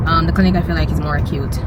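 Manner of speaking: ordinary delivery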